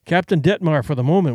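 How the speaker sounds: plain reading voice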